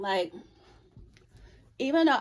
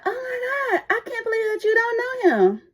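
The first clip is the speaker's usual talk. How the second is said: very high gushing shock; shocked coworker; 12 semitones higher, 5 dB louder than usual. Retold or imitated. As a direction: squeal in disbelief. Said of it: "She said".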